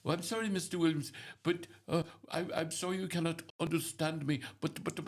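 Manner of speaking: stammering, polite mock Indian call-center agent; imitated Indian accent. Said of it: "they got that strong accent".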